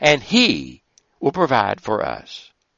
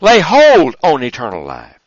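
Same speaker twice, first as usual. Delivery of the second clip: louder, each "Follow" hammered harder; fervent exhortation; 3 semitones higher, 6 dB louder than usual. Acted. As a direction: build the call like a preacher. Exhortation in a rising series.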